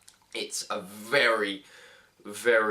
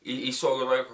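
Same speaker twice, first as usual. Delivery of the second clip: ordinary talk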